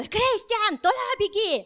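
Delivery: high, urgent mock yell; imitated shouting father. Father yelling directions to his child at the game, high imitated shout. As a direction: call out like a dad from the sideline. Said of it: "И все орут".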